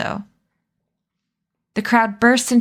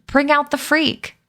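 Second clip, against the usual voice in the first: mild crowd shout; 7 semitones higher than usual. A narrator's reading. Crowd demanding the folding man appear, mild high shout. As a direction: call it out like a restrained heckler. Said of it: "People began to shout".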